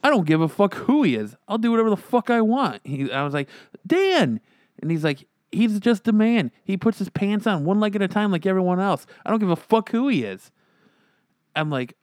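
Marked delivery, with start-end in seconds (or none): 0.0-2.8 defiant mock rant. Imitating rude friend. 3.9-4.4 shocked high reproach. Retold or imitated. 5.5-10.5 defiant mock rant. Imitating defiant friend.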